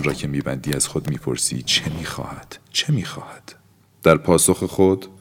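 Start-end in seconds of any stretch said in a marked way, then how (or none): 1.6-2.6 soft unvoiced whisper, falling; whispered question. A narrator's reading. Narrator whispering to himself. 2.7-3.5 high unvoiced whisper, stress on چه; whispered, rising urgency. A narrator's reading. Narrator asking himself again, whispered and urgent. Repeated question, pitched up.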